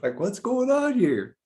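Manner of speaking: very high, slow, laughing squeak; laughing at his own bewilderment; laughing disbelief. Retold or imitated.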